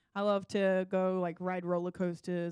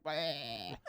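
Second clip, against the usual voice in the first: low, comic "bleh" of nausea; hammed-up queasy groan; mock retching noise; 5 semitones lower, 5 dB quieter than usual. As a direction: imitate throwing up, drawn out. Imitates vomiting after rides.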